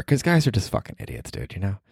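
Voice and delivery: usual speaking voice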